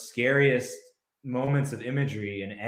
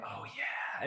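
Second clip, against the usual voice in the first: very soft, whispered come-on; imitated seductive whisper; 12 dB quieter than usual. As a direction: whisper it like the actress in a steamy scene. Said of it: "she's like".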